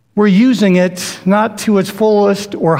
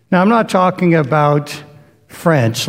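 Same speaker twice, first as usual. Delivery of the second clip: normal talking voice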